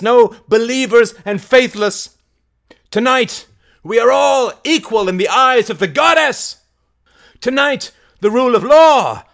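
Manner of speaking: high, loud rallying speech; Mary, fiery orator. Acted. Mary preaching revolt to the crowd, high loud oration. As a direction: declaim it to a crowd at full voice.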